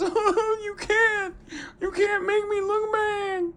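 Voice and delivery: very high, fake-crying whine; mock a host whimpering; hammed-up crybaby wail; whiny crybaby. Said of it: "That's what I hear".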